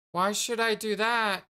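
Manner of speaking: character voice. Acted. Voicing character reply in game.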